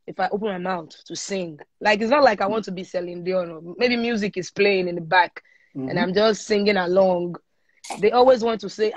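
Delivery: ordinary talk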